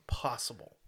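unvoiced whispered ending. Real, not acted. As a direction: trail off in a whisper. Whispered tail.

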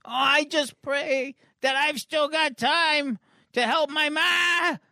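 put-on high, drawn-out pleading boy; Mitch. Boy prays for time to save his ma, high wail. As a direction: wail a slow, desperate prayer. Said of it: "we hear Mitch's internal thoughts again".

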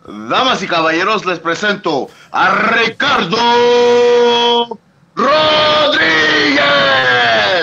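loud, slow Spanish ring announcer call; lucha libre announcer. Shown as a demonstration. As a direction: stretch the name out to the rafters. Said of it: "show him how it's done".

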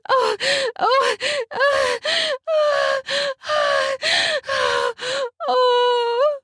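high, repeated moans of pleasure; rising moans. Acted. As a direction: moan in rhythm, high and breathy. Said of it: "pounding me as I moan".

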